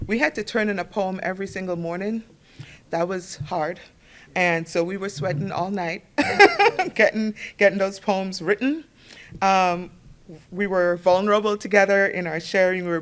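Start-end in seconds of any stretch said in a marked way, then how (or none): 6.1-6.9 high, loud burst of laughter; short real laugh; amused laugh. Real, not acted. Laughs recalling sleepless workshop nights.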